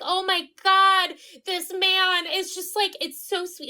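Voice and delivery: swooning outburst, easing off in volume; gushing. Retold or imitated. Gushing retold reaction.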